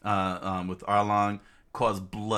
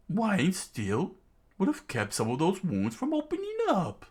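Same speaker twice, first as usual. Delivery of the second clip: line said in a much higher villain voice; wrong character voice; 11 semitones higher than usual. Acted. Kid voices the line as the villain by mistake, higher. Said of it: "that's, uh, Zolo's, uh, dialogue".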